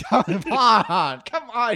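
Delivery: high, incredulous protest; exasperated. Real, not acted. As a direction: throw your hands up in disbelief. Exasperated about no American accent.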